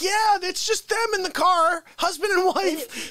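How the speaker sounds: high, slow sneering shout; mocking the masked couple, half laughing; mocking yell. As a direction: jeer at the couple in the car. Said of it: "Yeah, I'm like".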